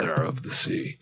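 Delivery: eerie whispered Mither of the sea; spooky whisper. Pretend. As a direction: breathe it out like a ghost story. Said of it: "the tone of that sounds scary".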